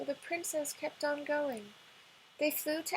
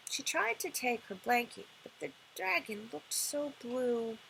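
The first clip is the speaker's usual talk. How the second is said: plain reading voice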